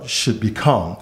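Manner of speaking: ordinary talk, nothing marked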